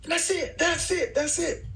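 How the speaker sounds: high, thrilled repeated that's it; excited approval. Retold or imitated. Bruno approving the new take, excited. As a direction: call it out high and delighted.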